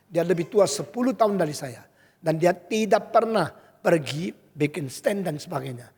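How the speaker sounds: ordinary talk, nothing marked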